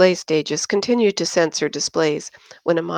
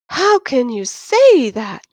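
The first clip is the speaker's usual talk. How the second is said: much higher, louder, slow tearful protest; tearful cry; 7 semitones higher, 6 dB louder, slower than usual. Acted. Christine protests to Yin about her boyfriend, tearful. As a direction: protest as tears well up. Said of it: "Christine cried".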